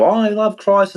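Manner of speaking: high fake-pious imitation; mocking churchgoers. Mocking fake Christians, high sneering voice. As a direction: parrot their fake piety up high. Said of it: "so many people".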